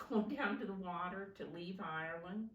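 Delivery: ordinary delivery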